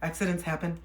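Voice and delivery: soft, gentle reassurance; quiet calming voice; soothing. Retold or imitated. Reassuring a crying stranger after an accident, softly. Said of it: "I'm like".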